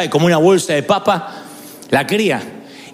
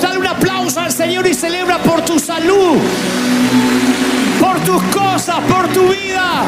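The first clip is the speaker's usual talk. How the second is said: much higher, shouted revival preaching; preaching at full force; 10 semitones higher than usual. Acted. Preacher rallying the congregation to celebrate, shouted fervent preaching. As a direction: whip the crowd into celebration.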